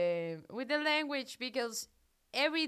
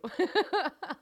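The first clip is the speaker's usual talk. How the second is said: shy laugh; 6 semitones higher, 4 dB louder than usual. Real, not acted. Thanked by listener.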